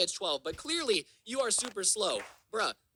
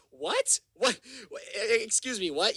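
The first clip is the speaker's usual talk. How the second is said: stunned disbelief. Real, not acted. Accused of kidnapping by the neighbor, he sputters in disbelief. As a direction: pitch jumps up on a stammered what.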